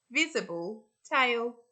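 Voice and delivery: ordinary delivery, nothing marked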